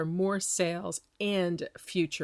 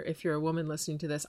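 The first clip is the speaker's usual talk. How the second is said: normal talking voice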